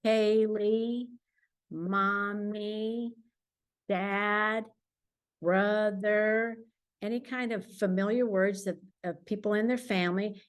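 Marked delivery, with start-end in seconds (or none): none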